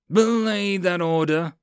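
high, slow, drawn-out countermand; angry roar. Acted. Sergeant countermanding lieutenant before the men, angry roar. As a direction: roar it to override an order. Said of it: "Mills roared angrily".

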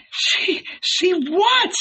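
very high, startled question; surprised. A narrator's reading. Mary, holding back tears, asks Salome, surprised. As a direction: ask in high-pitched bewilderment. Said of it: "looked at her with surprise".

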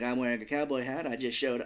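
ordinary delivery

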